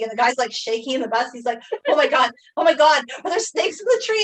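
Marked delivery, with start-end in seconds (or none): 1.9-4.2 high, loud mock terror about snakes in the tree; imitating panic. Classmate on the bus, scared of snakes: mock panicked, high and loud. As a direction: ham up a scared classmate's frantic fear. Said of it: "And he's, like, shaking".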